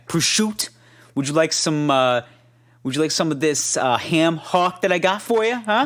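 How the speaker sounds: hammed-up Jersey uncle offering ham hock; Jersey accent.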